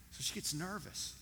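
usual speaking voice

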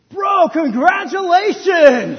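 high, loud, drawn-out cheer; mock-excited shout of congratulations; gushing congratulations. Friends congratulate the expecting father, over-excited. The response of people.

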